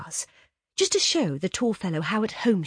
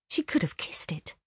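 whispering; 4 dB quieter than usual. Acted. Read in a whisper.

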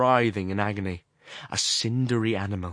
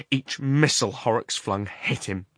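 ordinary delivery, nothing marked

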